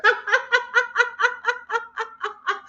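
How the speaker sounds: high, pulsing forced laugh; cheese laughter. Shown as a demonstration. Yoga leader demonstrates cheese laughter, high rhythmic laugh. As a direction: laugh deliberately in even, high bursts. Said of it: "It's cheese laughter".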